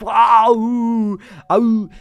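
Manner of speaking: loud, high shocked "ah, ooh" cries; shocked gasps. Real, not acted. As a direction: gasp out in disbelief, loud and high. Reacting in shock to racist tweet.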